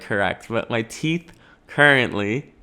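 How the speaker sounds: ordinary talk, nothing marked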